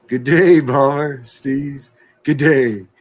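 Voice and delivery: loud mock Australian good day; calling out an Aussie g'day; loud Aussie greeting. Shown as a demonstration. To Bomber Steve, a loud mock Aussie hello. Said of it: "You need to say, "Good day, mate".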